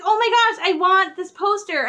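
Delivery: high, loud retold fangirl cry; freaking out. Fan retelling her reaction to her brother's warning, excited outcry. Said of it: "I screamed, and I freaked out".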